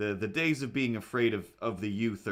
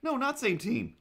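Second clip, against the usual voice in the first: sudden realisation. Retold or imitated. Realising the stranger isn't masked, dismayed. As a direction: catch yourself in dismay. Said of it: "I'm like".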